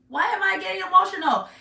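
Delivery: real welling emotion, high and loud; getting emotional. Real, not acted. Tarot reader moved by her own reading, getting emotional. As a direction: voice jumps up as feeling takes over. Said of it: "it makes me emotional".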